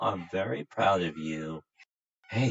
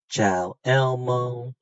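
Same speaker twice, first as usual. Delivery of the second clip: slow and drawn out, higher on "Elmo"; slow demo greeting; 4 semitones higher, 4 dB louder, slower than usual. Shown as a demonstration. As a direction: model "Ciao, Elmo" slowly for the child to copy. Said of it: "We're gonna try".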